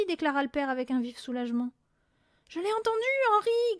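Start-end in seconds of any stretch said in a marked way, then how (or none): 2.5-3.8 high, frightened yelp; quavering yelp. A narrator's reading. Mother insisting to her husband, quavering yelp. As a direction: yelp it in a panic. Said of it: "glapit la mère d'une voix toujours aussi chevrotante".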